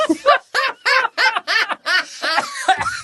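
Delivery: high, loud, helpless cackle; long high peals of laughter; big laughing fit. Real, not acted. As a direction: burst out laughing uncontrollably. Cracks up at own words.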